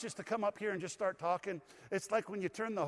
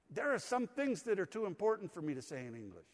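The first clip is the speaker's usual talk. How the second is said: ordinary talk, nothing marked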